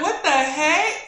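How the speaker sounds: loud, high-pitched disbelief; mock shock. Reacting to own accent while editing, mock shock. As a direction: exclaim in hammed-up astonishment.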